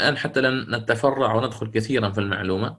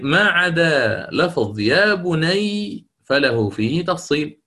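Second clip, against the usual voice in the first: slow emphatic quote; 4 semitones higher, slower than usual. Shown as a demonstration. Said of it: "قلت في الأخير".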